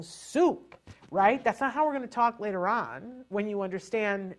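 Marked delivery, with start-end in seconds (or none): 0.0-0.8 put-on high, blunt single word Soup in a Russian accent; just bark Soup like a Russian woman; Russian one-word order. Russian woman ordering just soup, Russian accent. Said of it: "like the Russian woman, you know, saying".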